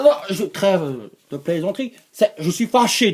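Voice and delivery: normal talking voice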